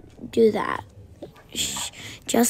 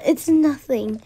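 ordinary talk

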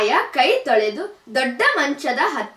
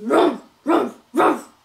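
big bear grunt; 2 semitones higher than usual. A narrator's reading. Big bear calls out on coming home, bear grunt. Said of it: "ಎಂದು ಕೂಗಿತು".

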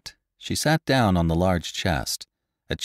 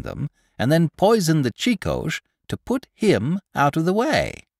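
higher, eager gossip's voice; chattering market woman spreading news; gossipy old woman; 6 semitones higher than usual. Acted. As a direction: relish every grisly detail like a village gossip. Pundor tells the murder story.

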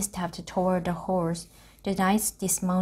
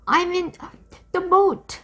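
calling out; 12 semitones higher, 6 dB louder than usual. A narrator's reading. Jack calling up from water.

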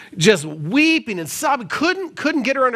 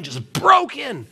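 ordinary delivery

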